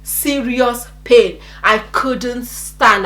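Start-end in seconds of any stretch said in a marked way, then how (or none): none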